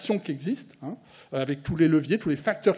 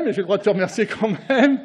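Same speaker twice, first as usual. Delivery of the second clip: louder, with a laugh running through it; laughing thanks; 3 semitones higher, 6 dB louder than usual. Real, not acted. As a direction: chuckle while thanking a friend. Teasing thanks to a friend.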